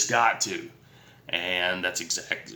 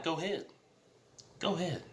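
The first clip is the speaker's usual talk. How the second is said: higher, softer, daring her to go on; a light higher taunt, inviting her to try; taunting dare; 7 semitones higher, 10 dB quieter, faster than usual. Retold or imitated. Daring her to call cops.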